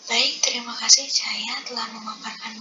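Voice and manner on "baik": ordinary talk, nothing marked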